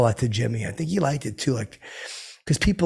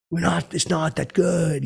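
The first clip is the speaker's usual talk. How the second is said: higher, slower [name] voice; [name] impression; 6 semitones higher, slower than usual. Comic copying drummer [name] on the album, light higher impression. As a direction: mimic his funny way of talking. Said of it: "I do the impression".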